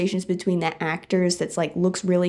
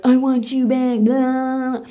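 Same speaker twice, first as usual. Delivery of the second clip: loud, raised mocking whine; mock pleading ex-boyfriend; 4 semitones higher, 5 dB louder, slower than usual. Ex-boyfriend turns up out of nowhere, loud mock pleading. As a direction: play an ex begging, hammed-up and loud. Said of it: "pulls up out of nowhere and is like".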